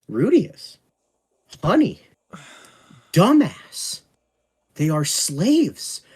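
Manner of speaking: loud, high, slowing exasperated scolding; exasperated rant. Real, not acted. Fed-up fan scolding Rudius for defending slavery, loud and exasperated. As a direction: spell it out to an idiot, word by word.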